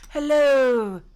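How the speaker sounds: high, very loud nanny greeting; Missus Doubtfire. Acted. Player echoing the movie greeting, Missus Doubtfire impression. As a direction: belt out a drawn-out, high hello. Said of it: "consistently be Missus Doubtfire".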